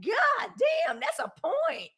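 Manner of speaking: high amazed exclamation. Retold or imitated. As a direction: blown away by the point. Reacting to brilliant point.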